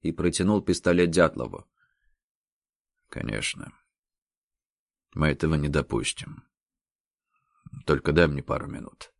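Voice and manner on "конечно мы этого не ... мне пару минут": grieving hush. A narrator's reading. Grieving over dead friend.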